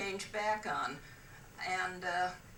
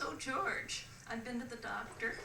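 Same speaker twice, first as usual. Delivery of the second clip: higher-pitched, pleased announcement; pleased wife; 5 semitones higher, 4 dB quieter than usual. Acted. Wife back from doctor to husband, pleased. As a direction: pitch it up, pleased to share news.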